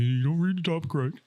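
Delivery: high, whiny imitation of a telling-off; mock yelling. Imitating Anthony yelling at him for misreading, mock yell. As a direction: mimic a scolding, pitch climbing. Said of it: "have Anthony yell at me".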